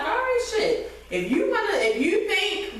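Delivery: mock high backing down.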